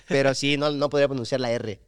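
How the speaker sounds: normal talking voice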